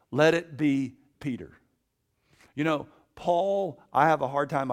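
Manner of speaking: loud, driving sermon voice; preaching at full force. Acted. Preacher urging students to follow Peter, at full force. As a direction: press the point hard on the crowd.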